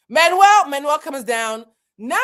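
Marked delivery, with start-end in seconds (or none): 0.1-0.7 loud, high yell for help; exasperated summons; calling out. Retold or imitated. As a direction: holler the name through the house. Story, yelling for Manuel.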